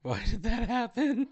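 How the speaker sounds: high, laughing disbelief. Real, not acted. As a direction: ask through a laugh. Glitch, GM laughing.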